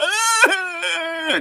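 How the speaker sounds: high, loud fake whine; mock whining wail. A kid whining to his mother, mock wail. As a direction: let out a hammed-up childish wail. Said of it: "I couldn't just".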